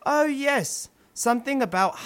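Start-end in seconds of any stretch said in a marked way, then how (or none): none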